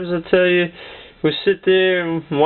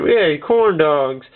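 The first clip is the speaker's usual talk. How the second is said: usual speaking voice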